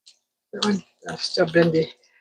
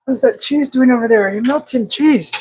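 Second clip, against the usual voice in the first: ordinary talk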